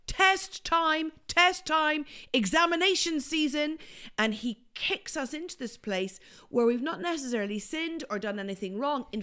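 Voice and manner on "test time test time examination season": high-pitched mock announcement; God announcing a test. Host voices God springing a test, high mock announcement. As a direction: call it out high, like an exam announcer. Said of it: "and then God says".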